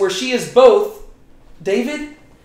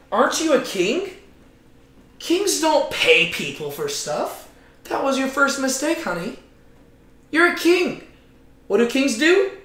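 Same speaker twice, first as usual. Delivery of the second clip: sassy scolding wife; 6 semitones higher than usual. Acted. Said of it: "She's like".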